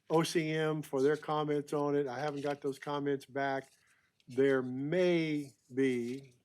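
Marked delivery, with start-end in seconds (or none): 4.3-6.3 "may" drawn out slow and leaned on, a caution not a promise; slow down and press hard on "may"; stress on may. Real, not acted. Permits officer warns the committee of possible mitigation, stressing "may". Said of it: "emphasize the word may".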